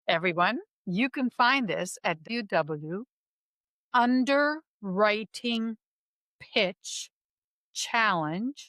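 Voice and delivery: slow, word-by-word teacher dictation. Acted. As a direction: spell it out for a class, pausing between words. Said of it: "go ahead with my teacher voice".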